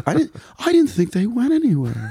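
high, laughing self-doubting voice; questioning yourself voice. Acted. Mocking his own confidence, high and unsure. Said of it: "That was good".

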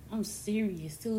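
ordinary delivery

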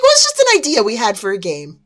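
innocent widow voice. Retold or imitated.

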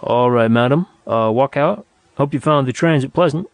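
polite operator addressing a customer; mild service-counter politeness; courteous. Acted. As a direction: speak courteously to the lady. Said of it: "spoke courteously".

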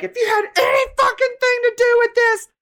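very high, loud shrieking rant; mock screaming Jen. Imitating Jen screaming in Meredith's face. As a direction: shriek it like a furious rival. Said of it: "Jen's like".

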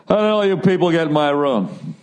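crooner greeting the casino crowd; put-on lounge star stage patter. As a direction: play the headliner taking the stage. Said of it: "the man is [name]".

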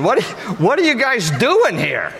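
high-pitched, incredulous scolding; exasperated disbelief. Acted. Jesus finding the disciples making a mess, exasperated disbelief.

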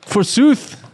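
high, grand Shakespearean declaiming; hammy stage actor. Acted. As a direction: ham it up like an Elizabethan actor. Mock-Shakespearean reprise of the line.

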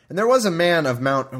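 scripture reading. Acted. Podcaster starting the chapter in a measured reading voice. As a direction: read the passage out with care. Reads Judges aloud.

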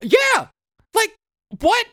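very high mock yeah; squeaky sarcastic agreement. Real, not acted. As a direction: scoff in disbelief. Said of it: "sarcastic disbelief".

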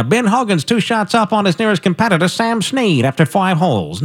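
lightly voiced vintage golf broadcaster; a hint of a newsreel golf reporter; old-time golf announcer. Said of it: "No, I didn't talk like that".

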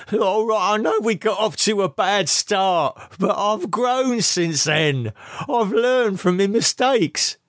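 high, desperate begging voice; pleading. A narrator's reading. Lee injured and begging Camilla, high desperate plea. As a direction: beg for help through the pain.

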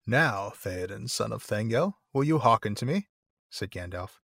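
slow, measured novel reading with pauses between phrases; grave storytelling with Gandalf's lines gently voiced; story narration. A narrator's reading. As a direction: read the tale aloud, slowly and deliberately.

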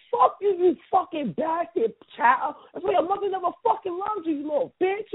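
high-pitched mock tirade; heated mock insult. Friend acting out an argument's cruel comeback, heated mock insult. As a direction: hurl it like a cutting comeback.

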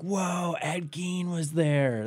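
put-on slow, hushed little-kid wonder; kids in awe. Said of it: "we were like".

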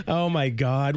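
high squealing laugh through the words; cracking up at her own slip; laughing too hard to talk; helpless laughter. Real, not acted.

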